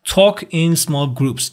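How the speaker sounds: "Talk in small groups" read very slowly and clearly; slow model reading. Shown as a demonstration. Teacher reading the task title slowly to the class. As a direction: spell out each word for learners. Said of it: "بریم برای بخش بعدی".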